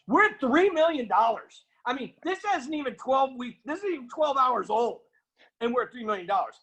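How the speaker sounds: thrilled that the money poured in overnight; excited. Real, not acted. Retelling news of three million dollars.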